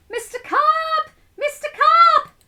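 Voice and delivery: very high, loud calling out; boy yelling. Acted. Boy calling for Mister Carp in the pool, high loud yell. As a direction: call out desperately across the water. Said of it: "I yelled".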